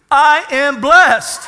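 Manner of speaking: loud, high, slow proclamation; drawn-out, forceful pulpit cry; congregational declaration. Shown as a demonstration. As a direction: call it out for the congregation to repeat. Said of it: "Say this with me".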